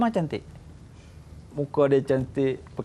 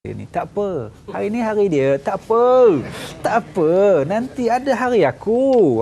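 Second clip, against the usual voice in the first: grudging inner vow; 4 semitones higher, 3 dB louder than usual. Retold or imitated. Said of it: "Tapi dia fikir apa?".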